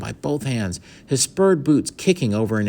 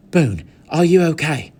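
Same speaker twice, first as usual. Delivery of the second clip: anxious; 7 semitones higher, 7 dB louder than usual. A narrator's reading. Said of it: "asked Patrick anxiously".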